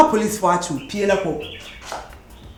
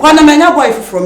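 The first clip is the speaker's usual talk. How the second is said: angry shout; 6 semitones higher, 8 dB louder, faster than usual. Acted. Quarrel, ordering partner out.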